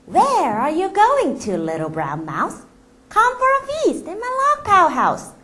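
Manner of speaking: slow, high coaxing snake voice; sly snake. Acted. The snake luring the mouse to its house, sly and slow. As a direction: tempt the mouse slowly and sweetly.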